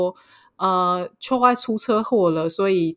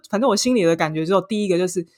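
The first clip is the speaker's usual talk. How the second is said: ordinary talk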